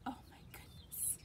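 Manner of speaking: whisper. Real, not acted. Awed at wacky picture.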